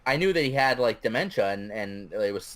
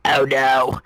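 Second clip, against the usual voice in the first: raspy, nasal Capone voice; loud gravelly old mobster groan; nasally gravelly voice; 8 dB louder than usual. Retold or imitated. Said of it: "nasally, you know, gravelly voice".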